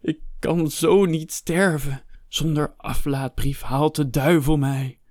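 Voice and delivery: high, slow, anguished lament; drawn-out cry of despair; wailing. A narrator's reading. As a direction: wail in dread of damnation. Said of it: "Jammerde Hector".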